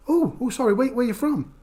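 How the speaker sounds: high-pitched mock confusion; flustered apology. Embarrassed pub stranger apologising to streamer, high flustered retelling.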